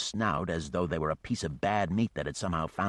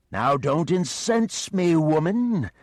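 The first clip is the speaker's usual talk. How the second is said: louder, much higher, slow beast voice; warning dragon; 10 semitones higher, 8 dB louder, slower than usual. Acted. The dragon warns Geneva, slow and looming. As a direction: threaten her like a monster losing patience.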